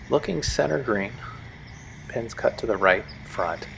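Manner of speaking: hushed golf announcer voice; quiet TV golf commentary; golf commentator. Acted.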